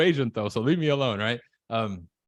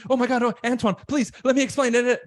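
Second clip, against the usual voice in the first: high, loud panicked begging; desperate pleading; 11 semitones higher, 5 dB louder than usual. Retold or imitated. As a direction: plead for your life, hammed-up. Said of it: "coming from a position of weakness".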